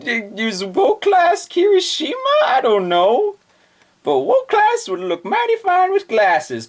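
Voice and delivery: very high, slow crying squeal; shocked girls. Acted. Goya and Shokaku gaping at Wo class, very high squeal. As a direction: whimper in disbelief. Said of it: "Goya and Shokaku are like".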